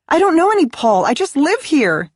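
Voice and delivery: high, frantic plea; desperate. Acted. Kenzie lying to the wife at the door, desperate and high. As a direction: bluff your way out in a panic. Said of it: "she said desperately".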